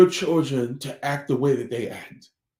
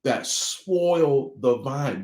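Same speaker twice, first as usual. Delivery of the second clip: slow quoted saying; 4 semitones lower, slower than usual. Retold or imitated. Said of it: "He said".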